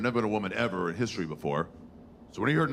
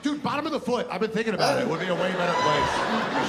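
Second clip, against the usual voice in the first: high, eager dude pitch; eager Adam; 7 semitones higher, 3 dB louder than usual. Acted. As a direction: sell the idea like a bro. Adam pitching idea, acted.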